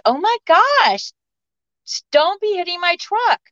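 high, startled protest; alarmed exclamation. Retold or imitated. To the unseen throwers, a high startled protest. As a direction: voice jumps up, shocked at the truck. Pine cones hitting her truck.

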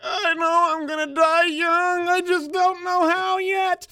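very high, slow, drawn-out cry; hammed-up loud, laughing lament; mock tormented wail. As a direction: wail it out like a cursed soul escaping. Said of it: "generational curse escaping their body".

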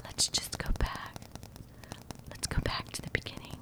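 very soft, unvoiced-sounding hushed whisper; mock ASMR host whispering. Shown as a demonstration. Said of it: "that I do an A S M R podcast".

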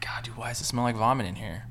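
hushed, breathy whisper of a groggy retold thought. Groggy guy in the bathroom smelling vomit, whispering. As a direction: keep it to a secret whisper.

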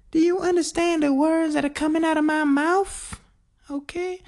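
very high, squeaky mimicry; movie-line impression. Quoting Rush Hour to himself, high-pitched impression. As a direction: throw out the line in a comic falsetto.

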